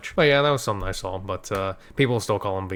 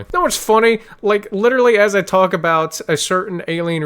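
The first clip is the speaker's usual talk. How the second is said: excited; 9 semitones higher, 8 dB louder than usual. Real, not acted. Sudden excited realization on stream.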